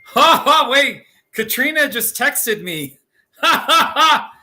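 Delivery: high laughing through the words; laughing at a funny text. Real, not acted. Texted by a friend, laughing.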